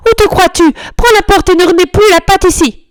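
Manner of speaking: high, loud furious scolding; furious lady. A narrator's reading. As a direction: throw him out, yelling. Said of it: "Madame est furieuse".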